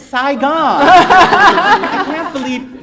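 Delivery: loud, high, laughing dad; a father cracking up at his mix-up; gleeful dad through laughter; laughing immigrant father. Acted. Reader voices the immigrant father.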